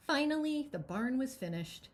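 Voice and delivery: usual speaking voice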